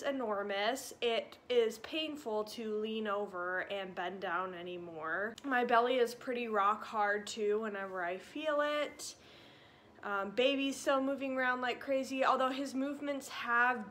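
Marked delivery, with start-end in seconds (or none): none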